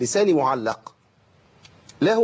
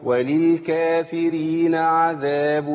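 تلاوة بطيئة ممدودة بنغم; رتّل الآية على مهل كالمعلم; تلاوة مجودة; much slower than usual. Shown as a demonstration. Teacher recites. (تلاوة مرتلة للطلاب)

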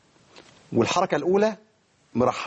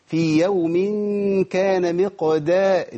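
slow recitation; 3 semitones higher, much slower than usual. Shown as a demonstration. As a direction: recite it drawn out with care. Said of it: "لازم أبين القلقلة". (تلاوة بطيئة جدًا)

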